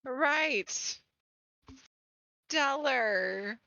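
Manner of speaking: dry, drawn-out sarcasm; mildly sarcastic approval. Acted. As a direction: say it as unimpressed sarcasm. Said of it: "It's just me being sarcastic".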